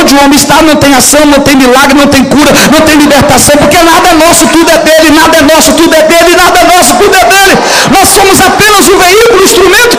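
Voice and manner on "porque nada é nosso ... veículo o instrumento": rising higher and louder with each repeat; building preacher shout. Real, not acted. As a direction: build the call to a full shout. (pregação crescendo até gritar)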